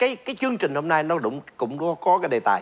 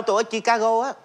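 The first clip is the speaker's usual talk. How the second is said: put-on Vietnamese accent; 7 semitones higher than usual. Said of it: "cái kiểu Việt Nam mình".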